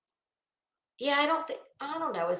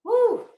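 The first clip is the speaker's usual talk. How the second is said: excited woo; 10 semitones higher than usual. Real, not acted. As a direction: whoop with delight. Cheering again.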